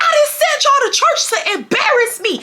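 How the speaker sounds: high mock screaming mom. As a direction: scold the kids like an outraged mother. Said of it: "she's screaming".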